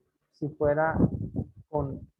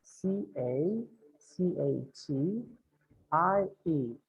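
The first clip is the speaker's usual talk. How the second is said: letters spelled very slowly; slow spelling; 3 dB quieter, slower than usual. Shown as a demonstration. Teacher spelling a name slowly in review. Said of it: "how do you spell that?".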